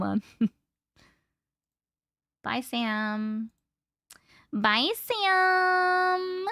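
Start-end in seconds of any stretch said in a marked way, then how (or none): 4.5-6.5 higher, drawn-out sing-song bye; sing-song goodbye. Real, not acted. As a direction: sing the goodbye, stretching Sam. Saying goodbye to a viewer.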